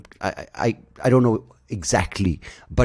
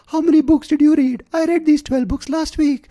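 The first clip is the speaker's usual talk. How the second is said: much higher, louder squeaky pitchman parody; mocking ad guy voice; 7 dB louder than usual. Mocking a smug YouTube ad guy's high pitch. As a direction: ham up the smug self-help guy. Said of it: "he sounds exactly like that".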